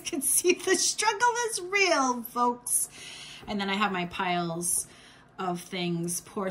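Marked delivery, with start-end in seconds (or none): 0.0-2.9 high loud playful exclaim; excited exclaim. Real, not acted. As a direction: burst out with mock drama.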